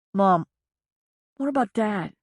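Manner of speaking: son's voice, fighting sobs; tearful son. Acted. Son tells leaving mother he loves her, tearful. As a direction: hold back the sobs as you say it. Son asks about father.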